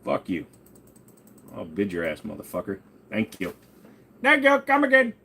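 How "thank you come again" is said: put-on much higher, louder shopkeeper accent; mock Indian accent. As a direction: thank the customer in a hammed-up Indian accent.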